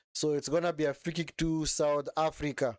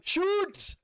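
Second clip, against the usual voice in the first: excited shout. Real, not acted. Live match, shot at goal.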